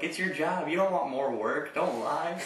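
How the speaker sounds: put-on high, laughing drunk voice; drunk customer teasing. Drunk customer teasing the cart boys, high and jokey. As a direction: rib them with a grin. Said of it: "He's like".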